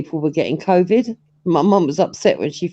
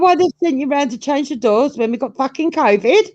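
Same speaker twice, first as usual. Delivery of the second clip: mum voice; 7 semitones higher than usual. Retold or imitated.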